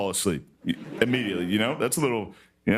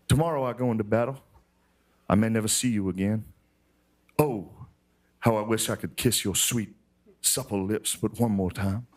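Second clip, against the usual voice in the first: mock-solemn documentary reading; hammed-up sentimental reading; dramatic letter reading. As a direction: read it like a tender Civil War letter. Reading a soldier's love letter.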